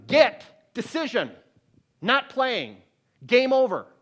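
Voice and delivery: loud, rising resolve; firm decision. Real, not acted. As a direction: hammer home each word. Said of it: "That foot stomping".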